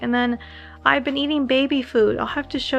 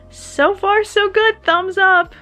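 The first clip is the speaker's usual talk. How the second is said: bright upbeat; 9 semitones higher, 6 dB louder, much slower than usual. Real, not acted. Cheery sign-off while sore.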